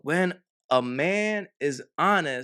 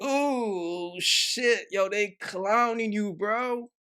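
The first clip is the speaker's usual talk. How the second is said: imitating her reaction; 6 semitones higher, slower than usual. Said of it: "She was like".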